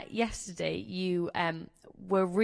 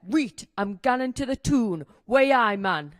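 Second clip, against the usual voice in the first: broad Newcastle accent, punchy finish; full-on Geordie accent; 5 dB louder, slower than usual. Shown as a demonstration. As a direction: model full-strength Geordie, loud on the catchphrase. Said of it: "Full on Geordie".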